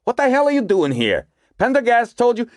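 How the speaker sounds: high, irritated scolding; angry. A narrator's reading. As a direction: scold her sharply. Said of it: "snapped angrily".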